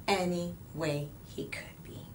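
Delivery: hushed closing echo; whispered echo. A narrator's reading. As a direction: whisper it back like a secret. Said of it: "repeated softly".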